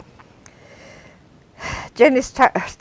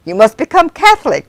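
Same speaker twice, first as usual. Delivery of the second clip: driver insisting; 6 semitones higher, 6 dB louder than usual. Acted.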